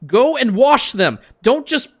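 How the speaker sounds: high, loud furious rant building to yelling; angry rant. Real, not acted. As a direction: rant at the game, fully fed up. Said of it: "That, that was my rant done".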